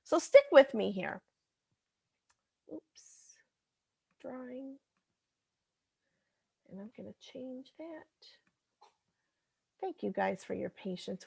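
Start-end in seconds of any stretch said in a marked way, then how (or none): 2.7-8.3 barely audible aside to oneself; absorbed muttering. Real, not acted. Teacher changing a drawing tool on screen, muttered to self. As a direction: mutter very softly while fixing something.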